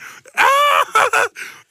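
high, loud howl of laughter; shrieking laugh. Real, not acted. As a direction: lose it completely. Big laugh at own joke.

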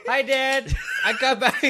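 put-on high, loud, laughing kid greeting; mock cheerful child. Kid tells dad he is back, mock cheerful. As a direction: play a happy kid, hammed up. Acting child home from school.

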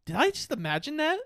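high, fast, puzzled; bewildered quote. Retold or imitated. Gosling questions his own memory, a high, puzzled tone. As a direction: ask it in confused disbelief. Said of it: "He goes".

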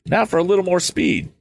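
high, eager exclamation; youth's excited self-encouragement; exclaiming. A narrator's reading. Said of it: "exclaimed the youth".

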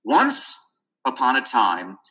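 put-on child still dropping the w in "Once", lifted; misreading once. Boy reading to his buddy, "Once" as "unce" rising. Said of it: "and then I said".

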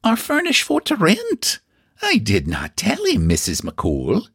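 furry tones. Acted. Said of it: "in her furriest tones".

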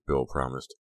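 plain reading voice

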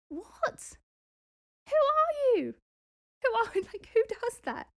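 can't believe what you just read; incredulous. Real, not acted. Reacting to the leaseholder's reply, high incredulous outburst.

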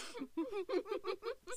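mock laugh, high and soft; Bethany. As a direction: imitate a friend's odd giggle. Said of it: "Bethany laughs like this".

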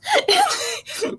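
squealing high laugh; a sudden high-pitched laugh; high burst of laughter. Real, not acted. Laughing at her own retelling of the towel scene, high and bright.

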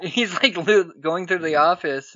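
ordinary talk

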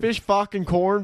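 high, slow mock Canadian; mock Canadian accent. Teasing his friend's attempt in a mock Canadian accent. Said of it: "you went Canadian there".

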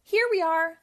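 puppy calling to its parents. Acted. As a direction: call out to mom and dad. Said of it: "called one puppy".

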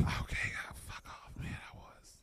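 hushed, sheepish whisper. Real, not acted. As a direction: breathe it out under your breath. Embarrassed whispered retort.

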